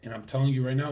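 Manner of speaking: normal talking voice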